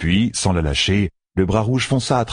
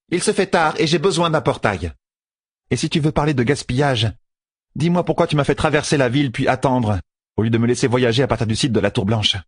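higher-pitched, mildly impatient reproach; impatient complaint; 6 semitones higher than usual. Acted. Fail needing a portal after waiting, impatient. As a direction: press her with a pointed, higher voice.

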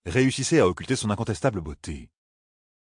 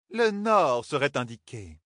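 voix lente et plus aiguë, calme; annonce-le tranquillement; Katsuane posée; 6 semitones higher, slower than usual. A narrator's reading. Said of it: "dit-elle". (Katsuane indique le nord, calme et lente)

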